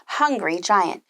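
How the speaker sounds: usual speaking voice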